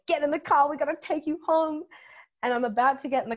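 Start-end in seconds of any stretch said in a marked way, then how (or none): 0.1-1.8 high, loud retold order; imitated frantic mother. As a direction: order them into the car, acting out a mum at her limit. Mother ordering daughter into car.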